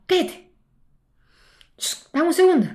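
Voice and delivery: high, urgent shush at the kayaker; panicked hush. Retold or imitated. She hushes the kayaker mid-panic, high and urgent. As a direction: hush him fast, high and tense.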